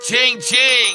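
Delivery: high sing-out of a till ringing; excited, high celebration; gleeful cash-register cry. Real, not acted. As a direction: ring it out in delight. Celebrating money rolling in.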